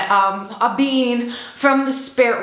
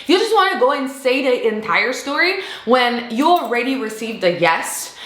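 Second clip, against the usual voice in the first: ordinary talk, nothing marked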